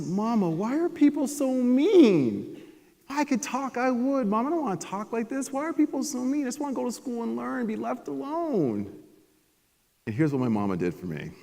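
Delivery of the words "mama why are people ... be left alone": put-on a crying child asking why people are so mean; sobbing little boy.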